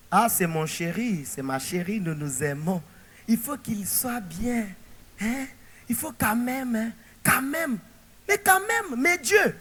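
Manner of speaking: soft, cooing lover's mimicry, then rising to a protest; mock lovers' voice. Preacher mimicking a couple in love, cooing.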